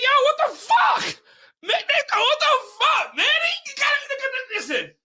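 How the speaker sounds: very high-pitched, exclaimed protest; acted outraged disbelief. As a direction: cry out high in mock disbelief. Acted protest at being stopped.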